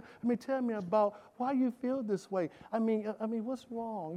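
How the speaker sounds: soft, hushed, gently pleading; a mock tender God babying Elijah; mock coddling God. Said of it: "You, you, you".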